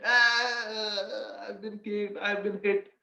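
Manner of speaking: high, drawn-out mock wail; acted boyish whine, held on one high note; imitated crying child. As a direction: wail like a kid who got kicked. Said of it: "the son of Gaura Das starts crying".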